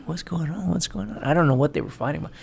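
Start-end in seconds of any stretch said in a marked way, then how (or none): 0.1-1.2 quiet. Retold or imitated. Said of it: "I was like".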